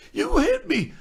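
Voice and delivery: very high-pitched indignant yelp; squeaked-up mock outrage; retold shocked protest. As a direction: imitate someone stunned at being slapped. Slapped actor protesting, retold.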